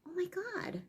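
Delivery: breathy, high shocked "Oh my God"; stunned by the insult; imitating a hurt teen; shocked gasp. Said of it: "And I thought".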